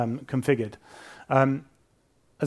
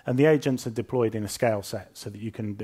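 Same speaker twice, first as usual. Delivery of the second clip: ordinary delivery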